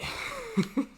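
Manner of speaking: shrill burst of laughter; high squeaky laugh. Real, not acted. Laughs at the metaphor.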